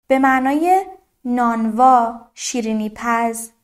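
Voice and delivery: normal talking voice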